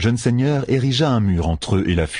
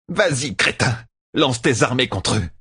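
higher-pitched, mildly acted venom; spat out angrily; 5 semitones higher than usual. A narrator's reading. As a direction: spit out the taunt with some scorn. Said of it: "éructa la traqueuse". (hargne légèrement jouée)

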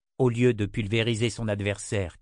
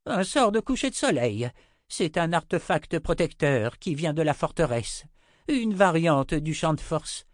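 high, insistent explanation; agitated old wizard; 7 semitones higher, 3 dB louder than usual. Acted. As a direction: lecture them urgently in an old wizard's high voice.

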